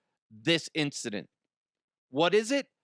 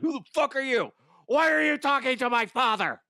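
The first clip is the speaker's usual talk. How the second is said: much higher, louder, put-on kid voice; mock grilling kid; 11 semitones higher, 4 dB louder than usual. Son grilling a fan who greeted his dad, mock high kid voice. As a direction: interrogate like a bratty child. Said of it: "Be like".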